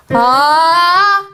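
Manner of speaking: high, loud strained "Ah" pushed up in chest voice; pulled chest yell. Shown as a demonstration. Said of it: "a person who goes".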